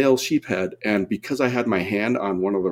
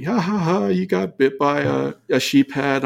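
high, teasing ha-ha taunt; mock the diver who got bit; mocking friends; 5 semitones higher than usual. Friends taunting him over the bite, high mocking laugh. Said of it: "everyone was, like, laughing at me".

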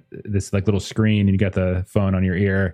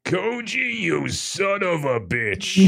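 slow, drawn-out greeting, the first word pitched up; gruff editor voice; 7 semitones higher, slower than usual. Acted. Editor greets the detective warmly, slow and drawn out. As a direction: relish the insult like an old friend. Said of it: "I really like that voice".

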